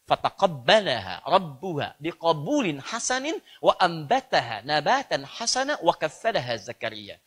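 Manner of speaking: slow, measured Arabic verse recitation; Quranic recitation. Real, not acted. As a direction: recite the verse slowly, word by word.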